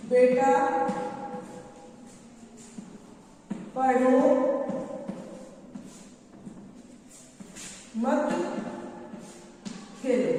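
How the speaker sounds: word by word, long pauses between; dictating while writing. Shown as a demonstration. Teacher writing a mother's words for the class, dictating. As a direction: dictate slowly as you write it out. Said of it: "वो मैं लिख रही हूँ यहाँ पे".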